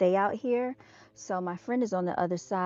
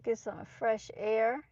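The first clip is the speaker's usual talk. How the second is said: usual speaking voice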